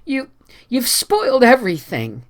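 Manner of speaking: high, halting, miserable lament. A narrator's reading. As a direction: stumble through the blame. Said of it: "said Anne miserably".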